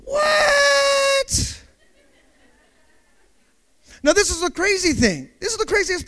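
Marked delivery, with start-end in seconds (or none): 0.0-1.6 high, drawn-out cry of shock; comically outraged, long high shriek; shocked disbelief. Acted. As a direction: stretch it into a high wail. Acted shock at car being impounded.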